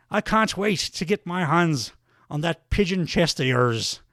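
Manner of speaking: mock Irish brogue, aggrieved wrestling promo; Sheamus. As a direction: call out a rival in a put-on Irish accent. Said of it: "some more accent work from me".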